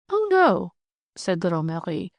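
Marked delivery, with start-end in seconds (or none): 0.1-0.7 a bright high "Oh, no" of protest; eager protest. Acted. Marie to Germain, sure she can cook it: a high eager protest. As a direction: jump in high and quick to wave off his doubt. Marie brushes off his worry.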